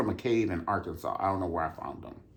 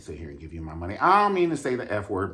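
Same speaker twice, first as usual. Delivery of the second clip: ordinary talk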